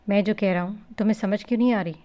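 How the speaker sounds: ordinary delivery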